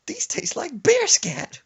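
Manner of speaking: very high, loud and slow disgust; grandma's disgusted squeal. Retold or imitated. Grandma judging the pork chops, high and loud disgust. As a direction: squeal it out in revulsion.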